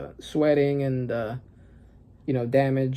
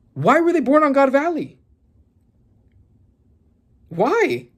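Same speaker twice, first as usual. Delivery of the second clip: much higher, louder demand; incredulous why; 10 semitones higher, 4 dB louder than usual. Real, not acted. Fan asking why the twins came from God Valley, incredulous. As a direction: beg the manga for an answer.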